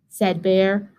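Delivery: ordinary delivery, nothing marked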